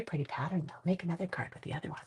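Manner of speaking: soft unvoiced aside; a whispered compliment on the pattern. Real, not acted. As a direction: breathe it out, no voice.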